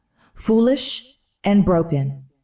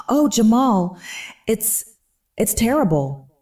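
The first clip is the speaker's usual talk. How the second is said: cracking voice; 4 semitones higher than usual. A narrator's reading. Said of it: "letting my voice crack and shatter".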